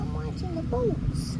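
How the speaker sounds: dog voice. Pretend. Voicing the dog again.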